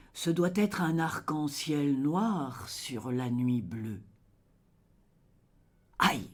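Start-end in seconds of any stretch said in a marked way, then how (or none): 6.0-6.3 short, sudden cry; sharp declaimed outcry; poetic cry of pain. Acted. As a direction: cry out once, cut off quickly. Poem's cry, after title Le cri.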